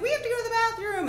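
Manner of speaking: put-on high, loud, quick child voice; little kids announcing. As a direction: pipe up like an urgent small child. Voicing her little nieces.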